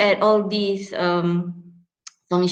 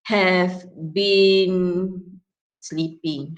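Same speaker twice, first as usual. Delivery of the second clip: slow demo; much slower than usual. Shown as a demonstration. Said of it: "used have as, in perfect tenses".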